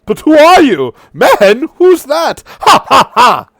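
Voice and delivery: high, loud gloating with a cackle; mocking Serpent King. Serpent King gloating over the dragonborn, high and cackling. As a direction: toy with him then laugh in his face. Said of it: "the Serpent King's going".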